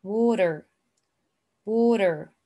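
American "water", ending on a clear r; American-style "water" with its r sounded; American accent. Shown as a demonstration. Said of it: "So this is the American accent".

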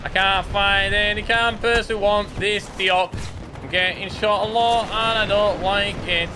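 high-pitched whining complainer; complaining put-on voice.

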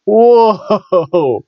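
high, drawn-out "whoa" that falls away; mock shock. Vlogger reacting to ever smaller trunks, mock shock. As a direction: exclaim it high in hammed-up shock.

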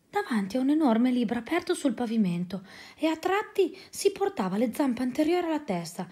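ordinary delivery

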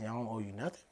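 whispered under breath; hushed timid objection; whispered protest. Retold or imitated. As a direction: mutter it barely aloud. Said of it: "I said".

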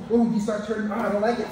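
ordinary talk, nothing marked

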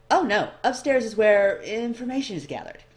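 bright curious clerk sizing up the newcomer; eager elf librarian. A narrator's reading.

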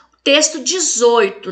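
normal talking voice

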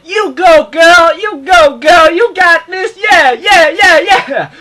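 loud, high cheer with a crack in the voice; shouted cheering. Retold or imitated. Cheering on Erica as she takes the fight, loud and high. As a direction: yell it like cheering at the screen.

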